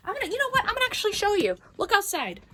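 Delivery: sudden high, fired-up build into sarcasm; worked up. Real, not acted. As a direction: push it up high, about to prove a point. Sarcastic rant about snow.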